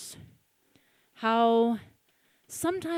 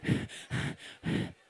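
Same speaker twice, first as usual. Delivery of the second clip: 1 distant scream; 7 dB quieter than usual. Acted.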